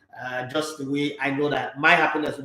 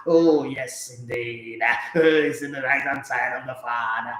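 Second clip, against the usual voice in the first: drawn-out mock preacher cadence; preacher impression; 4 semitones lower, slower than usual. Host parodying a church pastor preaching, in drawn-out mock-solemn cadence. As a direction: stretch the words like a pastor in the pulpit. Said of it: "the pastor preached like that".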